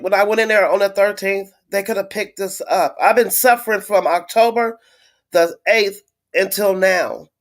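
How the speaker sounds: ordinary talk, nothing marked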